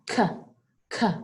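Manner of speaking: "K" said as a short isolated "kuh", twice; hard sound. Shown as a demonstration. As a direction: pop out "K" as its sound, not its letter name. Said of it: "Do you hear that hard sound?".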